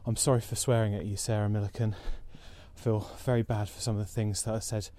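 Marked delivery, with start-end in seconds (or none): none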